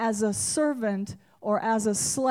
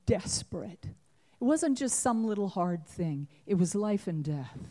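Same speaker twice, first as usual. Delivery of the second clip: ordinary talk, nothing marked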